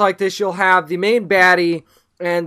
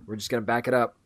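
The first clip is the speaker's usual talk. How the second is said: quiet; 9 semitones lower, 10 dB quieter, faster than usual. Retold or imitated. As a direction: lower and softer, backing off. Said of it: "all the cops are just like".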